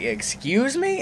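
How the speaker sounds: high offended mom; indignant, daring you to repeat. Retold or imitated. Mom answers her son's insult, high and offended.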